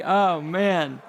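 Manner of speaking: usual speaking voice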